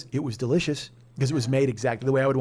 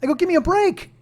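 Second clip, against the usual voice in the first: loud and high, worked up; exasperated husband venting about car dealers; heated retelling; 10 semitones higher, 7 dB louder than usual. Retold or imitated. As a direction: vent it like you're fed up. Retold exasperated speech to wife.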